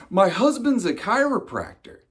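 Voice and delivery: high, loud mock volunteer shout; hammed-up announcement to a whole cabin; woman calling out. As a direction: pipe up urgently in a high put-on woman's voice. Woman calls out on plane.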